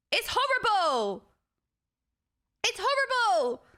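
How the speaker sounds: high-pitched cry of disgust; horrified exclamation. Real, not acted. Streamer reacts to their Essex demo, horrified. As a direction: wail it high, then let it fall.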